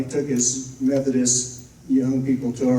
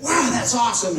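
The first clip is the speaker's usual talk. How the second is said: mock excitement; 9 semitones higher, 3 dB louder than usual. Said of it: "and I said".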